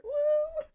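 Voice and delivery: very high, loud "woo"; excited whoop. Real, not acted. Fan cheering the High Lady reveal, excited whoop.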